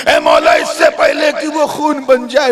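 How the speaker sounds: high, loud desperate call; loud pleading prayer; urgent plea. Acted. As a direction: shout it up to heaven. Youth pleads to God.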